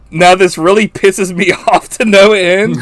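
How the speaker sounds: high, loud mock indignation; exasperated movie quote. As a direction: imitate the outraged line through amusement. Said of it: "the way he says that cracks me up".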